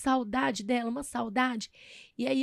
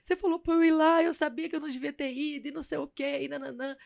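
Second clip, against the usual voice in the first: retold crying; 5 semitones higher than usual. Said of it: "liguei pra minha amiga chorando".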